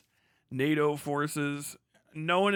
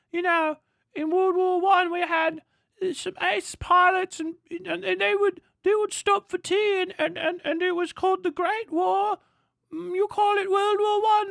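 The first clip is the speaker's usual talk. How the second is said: very high, loud, put-on British voice; mock British reminiscer; 6 dB louder than usual. UK boasting about World War One, mock British reminiscing. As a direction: ramble proudly in a squeaky caricature, stumbling over "and".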